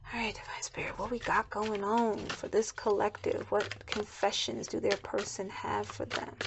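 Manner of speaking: soft, hushed prayer to Spirit; whispered prayer. Acted. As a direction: murmur a private request to the divine. Quiet prayer to Divine Spirit.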